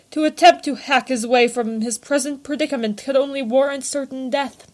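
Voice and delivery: plain reading voice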